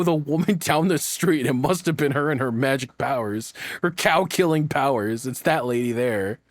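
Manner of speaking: ordinary delivery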